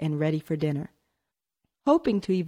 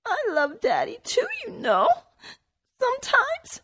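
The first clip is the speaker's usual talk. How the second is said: put-on much higher, trembling tearful girl; trembling words. A narrator's reading. Jennifer tells Tony she loves Daddy too, trembling tearfully. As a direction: hold back the tears as you speak. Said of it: "she gasped hard, trying to control her trembling words".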